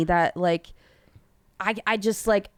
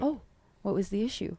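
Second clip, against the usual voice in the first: calm; 8 dB quieter than usual. Pretend. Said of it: "Just calmly".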